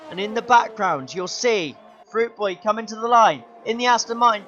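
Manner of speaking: ordinary talk, nothing marked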